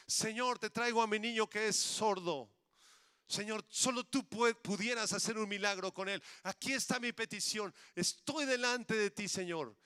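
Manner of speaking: high, loud cry to the Lord; fervent petition, pitched high; pleading prayer. Acted. Acted plea in prayer.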